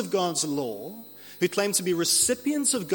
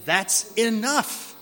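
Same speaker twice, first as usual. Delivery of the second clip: slow, higher-pitched, drawn-out telling-off; exasperated parent; 7 semitones higher, much slower than usual. Acted. Jesus cutting off apostles like arguing children, exasperated. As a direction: stretch it out like calling time on squabbling kids. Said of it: "might say to arguing children".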